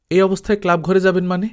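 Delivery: shocked protest to a feverish man; rising disbelief, quick and loud; incredulous objection. A narrator's reading. Chitra objects to him going out.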